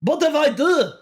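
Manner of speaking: high-pitched Aussie sliding into Scottish; Australian turning Scottish. Shown as a demonstration. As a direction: let the Australian accent drift helplessly into Scottish. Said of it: "it turns Scottish".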